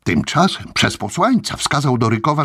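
ordinary delivery

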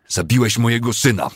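high, loud, anguished accusation; raging, grief-stricken shout; yelling. A narrator's reading. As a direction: scream the accusation at him. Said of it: "wrzasnął Cooper".

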